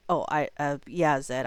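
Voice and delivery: normal talking voice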